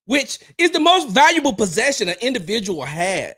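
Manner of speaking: high-pitched, emphatic berating; exasperated high-voiced dressing-down; scornful scolding. Real, not acted. Berates the troll.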